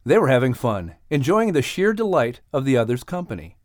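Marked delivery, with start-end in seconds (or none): none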